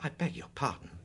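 soft, wounded reply; quietly hurt. Acted. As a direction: sound stung and hurt. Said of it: "said Mister Heine in a wounded voice".

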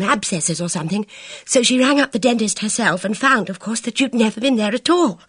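high, anxious rush of words; fretful girl's voice; agitated. Acted. Bridget pours out her worry.

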